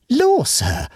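high-pitched astonished exclamation; startled maid's cry of surprise; undisguised astonishment. A narrator's reading. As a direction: exclaim it high, openly amazed. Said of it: "exclaimed in undisguised astonishment".

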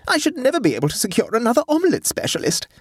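high-pitched plaintive wail. A narrator's reading. Hostess lamenting her omelette chef, high wail. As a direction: bemoan the loss piteously. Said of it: "wailed Sophie".

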